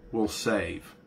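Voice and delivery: ordinary talk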